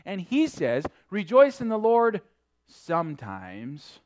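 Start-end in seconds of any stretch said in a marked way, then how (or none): 1.1-4.0 mock watered-down version. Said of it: "No, he said, "Always".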